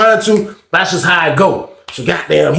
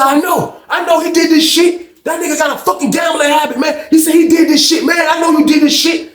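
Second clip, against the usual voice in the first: angry accusation; 12 semitones higher than usual. Retold or imitated. Accusing the other man.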